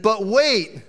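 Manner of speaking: loud, rising pitchman hype; infomercial voice. Pretend. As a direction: sell it like a TV pitchman. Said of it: "It sounds like an infomercial".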